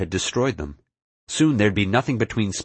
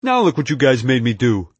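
higher, louder peeved complaint; irritated outburst, pitched up; 5 semitones higher, 5 dB louder than usual. A narrator's reading. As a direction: whine the blame at them, annoyed. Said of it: "he looked peeved".

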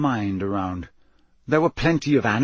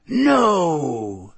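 higher, drawn-out creature voice; hoarse sphinx refusal; 5 semitones higher, 4 dB louder than usual. A narrator's reading. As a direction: refuse with a long, imperious "no". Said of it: "in a deep, hoarse voice".

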